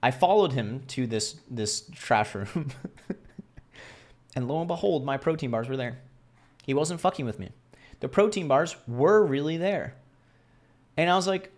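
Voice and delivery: ordinary talk, nothing marked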